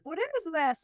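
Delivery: high, curious what-is-this; puzzled question. Retold or imitated. Passenger reading the seat-pocket card, high and puzzled. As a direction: lift it up in mild wonder. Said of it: "I'm like".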